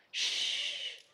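soft unvoiced shush; whispered shush. Raggedy Ann hushing Raggedy Andy, whispered. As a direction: breathe the shush out without voice. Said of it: "whispered Raggedy Ann".

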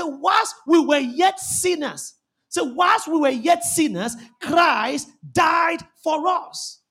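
slow, loud, drawn-out proclamation; preacher thundering the verse to the church; preaching at full force. Acted.

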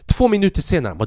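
normal talking voice

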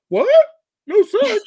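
high, loud mock disbelief; mock shocked guy. The daughter's boyfriend hearing the rule, hammed-up shock. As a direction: squeal it like a stunned boyfriend.

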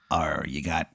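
low, very soft pirate voice; pirate tomato. Acted. Pirate tomato gives Aaron five seconds, low and hushed. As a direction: give a hushed pirate ultimatum. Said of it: "he's a pirate".

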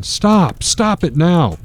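a mother's frantic plea; crying out stop. Acted.

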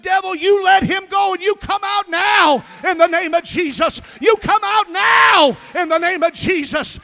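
loud high commanding exorcism shout; shouted rebuke. Retold or imitated. Preacher casting out a devil, shouting. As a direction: drive the devil out with your voice. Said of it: "And I said".

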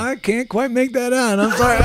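high, loud, feigned innocence; coy playing dumb; mock innocent. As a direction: play dumb with a grin. Mock innocent reply to general.

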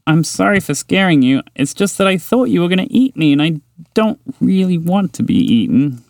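a little mouse saying sorry, a touch nervous; timid mouse. Acted. Mouse character apologizing.

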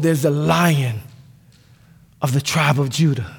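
low, soft and reassuring; gentle comfort. Acted. Elder tells weeping John to look, low soft comfort. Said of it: "the elder steps in and says".

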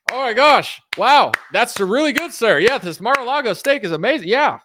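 high, loud starstruck gushing; mock-amazed sycophant squeal; gushing fans. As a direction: fawn over him in an exaggerated high voice. Crowd gushing at Trump's boasts.

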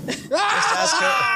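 squeaky high mock voice; high mock imitation. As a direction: parrot it back in a shrill high voice. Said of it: "That doesn't sound like me".

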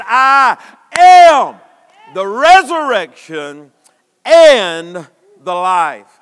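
loud, slow proclamation of I am; booming declaration. Acted. Preacher voicing Jesus to Martha, loud and slow. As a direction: boom each word out.

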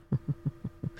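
breathy quiet chuckle; a quiet laugh under the breath; scoffing at the poll numbers, soft chuckle. Real, not acted.

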